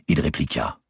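usual speaking voice

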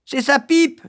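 cri aigu d'une fillette ravie; crie, secouée de joie; cri joyeux. A narrator's reading. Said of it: "criait la petite sœur". (la petite sœur crie la réponse, ravie)

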